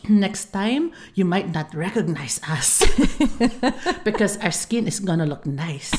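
lightly voiced joking dad. Daughter imitating her dad joking about their skin, lightly voiced. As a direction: tease gently like her dad. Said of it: "and then jokingly".

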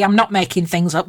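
normal talking voice

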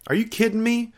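incredulous disbelief. Retold or imitated. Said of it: "I was like".

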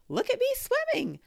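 high, loud squeaky little voice; a tiny joey showing off; little Roo squeaking with pride; squeaked. A narrator's reading.

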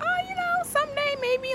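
very high, fake-casual singsong; playing it off. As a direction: brush it off too breezily in a squeaky-high voice. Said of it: "just trying to play it off".

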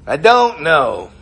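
slow, drawn-out noncommittal answer; used car salesman. Acted. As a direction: stretch out the salesman's dodge. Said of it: "used car salesmen".

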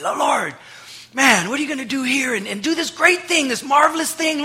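high, loud, eager prayer for a sign; excited asking. Retold or imitated. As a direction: beg breathlessly for a marvel. Retelling his eager prayers for signs.

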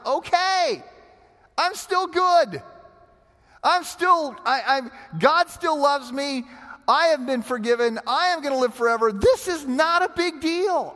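a cheerful shrug of a voice, pitched well up; retold Edwards quote. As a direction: play the unbothered pastor, airy and high. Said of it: "He just says".

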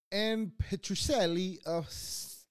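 Petrocelli in a high, drawn-out wrong accent. Shown as a demonstration. Host botching Petrocelli while reading truffle history, wrong accent. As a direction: put a big, overblown wrong accent on Petrocelli. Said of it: "no, that's the wrong accent".

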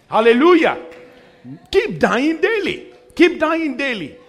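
high-pitched, ringing exhortation; rousing preaching. Real, not acted. Pastor closing the point to the congregation, rousing call.

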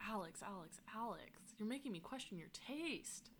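sighing, very soft disappointment; hushed, disappointed reproach; quiet dismay. Real, not acted. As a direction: drop the volume right down, let down by a friend. Dismayed at friend's rating.

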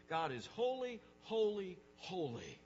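"holy" proclaimed three times with weighty pauses; emphatic repetition. Shown as a demonstration. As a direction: HOLY hit separately each time, high and loud, last one dropping. Preacher stressing the threefold repetition.

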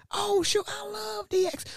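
squealing fanboy falsetto; excited. Retold or imitated. Dad gushing to guest about wrestling, excited. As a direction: gush in a very high put-on voice. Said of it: "he got excited".

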